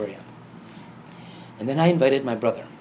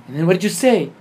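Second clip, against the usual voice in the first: loud, high, urgent question; acted-out excited questioning; eager prompting; 6 semitones higher, 8 dB louder, faster than usual. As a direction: demand to know his answer. Retold eager questioning of brother.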